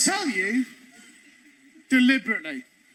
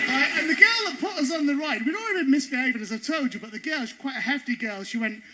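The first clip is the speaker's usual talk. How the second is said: ordinary talk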